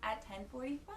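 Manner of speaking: normal talking voice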